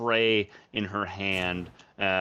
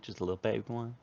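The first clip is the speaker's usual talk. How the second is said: ordinary talk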